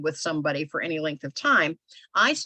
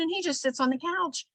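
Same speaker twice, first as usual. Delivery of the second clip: mock complaint; 10 semitones higher than usual. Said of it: "And she said".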